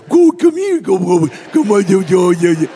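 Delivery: playful pet-calling voice; voice for a dog. Retold or imitated. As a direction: call the dog like you would at home. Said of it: "we use a different voice with animals".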